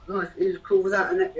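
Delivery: measured poetry line; spoken word poem. Acted. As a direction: pick the poem back up. Resumes the poem.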